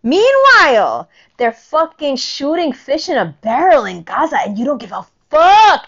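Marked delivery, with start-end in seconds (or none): 0.0-1.1 loud emphatic meanwhile. Real, not acted. Repeated for emphasis. 4.4-5.8 loud furious accusation; angry. Real, not acted. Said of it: "I told you I'm angry today".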